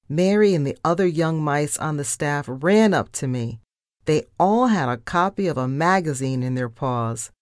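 ordinary delivery, nothing marked

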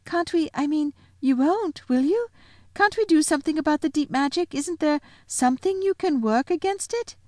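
put-on very high, urgent pleading child; stammer, then hurry into an anxious plea; high-pitched, beseeching girl; whispering girl. A narrator's reading. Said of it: "whispered Susan in the lion's ear".

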